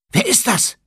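high, loud startled question falling at the end; startled girl. Acted. Hermine collides with someone in the dark, startled high cry. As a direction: blurt it out, startled.

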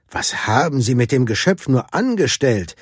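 ordinary delivery, nothing marked

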